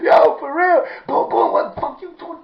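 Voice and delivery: loud, high, hyped shouting; excited shout. Real, not acted. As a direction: belt it out, laughing at the memory. Reliving the fight, shouting.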